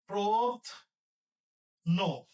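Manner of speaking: very slow syllable; drawn-out dictation. Shown as a demonstration. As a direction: draw out for writing. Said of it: "dictating syllable".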